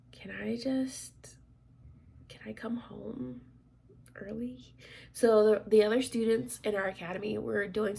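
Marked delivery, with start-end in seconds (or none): 0.1-4.7 slow, soft whisper. Retold or imitated. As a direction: plead weakly under the breath. Asking to go home while sick.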